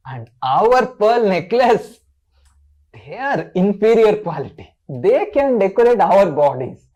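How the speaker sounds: loud, slow, emphatic scorn; Lalita. Acted. Lalita rebuffs Krishna over his cows, angrily sarcastic. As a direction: draw out each point in mock-indignant sarcasm. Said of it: "Lalita looked angrily".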